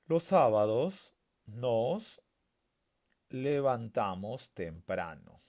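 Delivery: very slow, broken after "nos"; drawn-out classroom reading; slow dictation. Shown as a demonstration. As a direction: dictate it in pieces, stretching "sábados" and "nos". Teacher first reads sentence in pieces.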